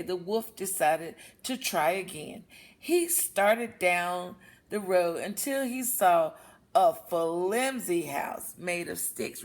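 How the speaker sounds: usual speaking voice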